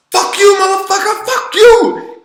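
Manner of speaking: high, loud retold screaming tantrum; going nuts. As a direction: imitate a kid screaming abuse at full pitch. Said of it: "just kinda goes nuts".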